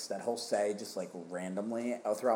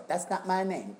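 high sing-song dad voice; goofy dad catchphrase, pitched up; dad impression; 10 semitones higher than usual. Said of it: "he would just go".

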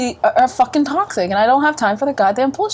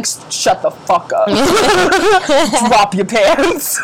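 laughing; 2 semitones higher, slower than usual. Retold or imitated. Playful quote to partner, laughing.

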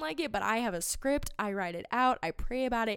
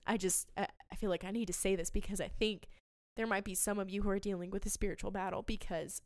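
ordinary delivery

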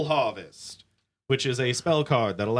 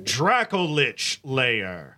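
slow theatrical villain menace; drawn-out, ominous villain naming; much slower than usual. Pretend. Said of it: "This villain voice that you keep putting on".

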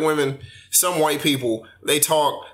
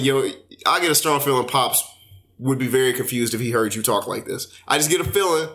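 ordinary delivery

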